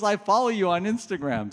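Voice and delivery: high-pitched imitation of an eager follower; excited fan. Student tells the speaker she follows online, excited. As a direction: play her thrilled admission, voice lifted.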